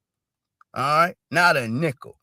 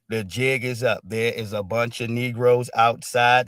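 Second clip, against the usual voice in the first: ordinary talk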